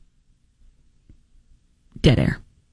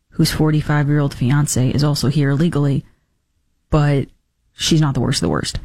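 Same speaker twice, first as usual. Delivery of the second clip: a hammed-up lower voice of a disappointed Trump fan; mock disillusioned voter; 9 semitones lower than usual. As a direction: play the naive voter who just woke up. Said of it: "what I'm supposed to take away from this".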